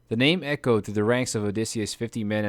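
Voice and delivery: usual speaking voice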